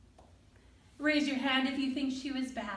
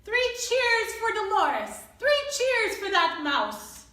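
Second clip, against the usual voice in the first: loud, higher festive cheer; cheering crowd; 8 semitones higher, 5 dB louder than usual. Acted. Audience cheering for the diva and mouse, loud.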